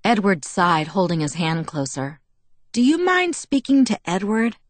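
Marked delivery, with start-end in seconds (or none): 2.7-4.6 high, tentative request; apprehensive. A narrator's reading. Bella asks Jacob to talk to Edward, apprehensive. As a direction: ask it carefully, pitched up. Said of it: "I asked apprehensively".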